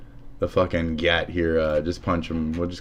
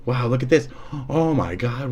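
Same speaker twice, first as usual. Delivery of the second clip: ordinary talk, nothing marked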